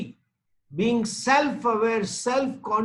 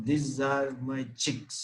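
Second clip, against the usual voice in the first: put-on lower, softer little-child reply; baby answering; 6 semitones lower, 5 dB quieter than usual.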